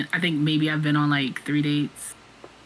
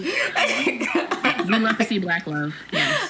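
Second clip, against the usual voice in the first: laughing; 3 semitones higher, much slower than usual. Real, not acted. Laughing cheer for married friend.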